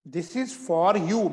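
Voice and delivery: very slow and separated; slow dictation. Shown as a demonstration. Teacher dictating before saying it normally, slow. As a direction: dictate each word for writing. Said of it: "सामान्य भाषा में बोल रहा हूं".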